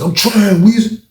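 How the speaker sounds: high, agitated mock protest; imitating tearful complaint. Inmate with one tear venting to Weezy, imitated upset. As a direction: act out an upset inmate pleading his case. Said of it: "it was like one tear".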